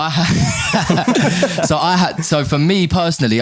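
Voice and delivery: talking through a laugh. Real, not acted. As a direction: laugh while getting the words out. Laughing while explaining himself.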